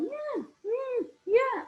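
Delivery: high, absent-minded "yeah, mm"; half-listening while watching TV; distracted listener. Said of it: "you're watching the T V and you're going".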